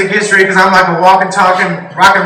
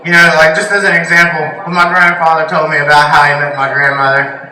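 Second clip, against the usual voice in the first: ordinary talk, nothing marked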